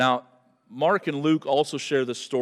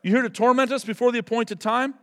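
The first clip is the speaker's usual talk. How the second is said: demons pleading; 9 semitones higher, 4 dB louder than usual. Acted. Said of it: "They're like".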